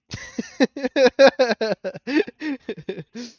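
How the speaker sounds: shrieking laugh; loud high laughter. Real, not acted. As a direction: burst out laughing. Laughing at chat question.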